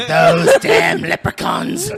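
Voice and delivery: loud hammed-up leprechaun shout. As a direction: bellow it like a cartoon leprechaun. Joke about tiny leprechaun people.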